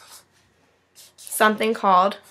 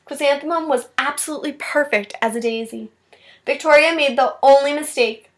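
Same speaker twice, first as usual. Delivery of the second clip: put-on expressive storybook read-aloud for kids; reading to children. A narrator's reading. Teacher reading a picture book to her class, expressive read-aloud. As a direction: read it like story time, voicing each child.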